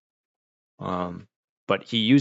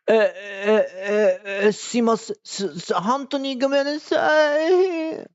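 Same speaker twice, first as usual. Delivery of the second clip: much higher, slow, stammering mock apology with a mock cry; hammed-up flustered officer begging forgiveness; police officer grovels to the man they stopped, higher and slow with a whimper; panicked apologizing cop; 12 semitones higher, slower than usual.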